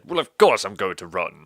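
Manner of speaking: loud, put-on posh Boris voice; hammed-up Boris Johnson voice; Boris impression. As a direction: boom it out like Boris. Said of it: "he said".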